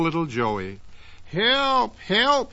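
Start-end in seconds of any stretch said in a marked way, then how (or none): 1.3-2.5 high cries for help while falling; crying for help. Acted. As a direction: call out in a panic as you drop. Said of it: "he cried".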